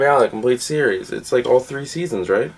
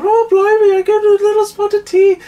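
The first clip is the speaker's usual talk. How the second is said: very high, loud mock-British squawk; hammed-up American attempt at British, pitched way up; horrendous British accent; 7 dB louder than usual. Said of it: "like, horrendous accent of, like, Americans doing British".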